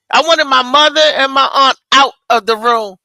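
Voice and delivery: normal talking voice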